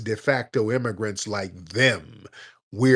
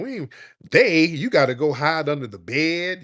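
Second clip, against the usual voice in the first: ordinary talk, nothing marked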